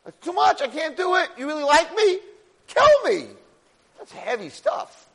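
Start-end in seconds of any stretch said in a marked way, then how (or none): 0.0-3.3 very high, loud breakdown; desperate outburst. Retold or imitated. Moshe breaking down before Hashem, desperate outburst. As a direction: let it break out, climbing to a shout.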